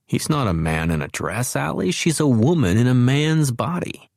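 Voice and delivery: a hint of a stern lecturing man; mild reproach, lightly voiced; stern Colin Wren. A narrator's reading. Allie's inner voice of Colin scolding her, mildly stern. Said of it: "a stern thought then in Colin Wren's voice".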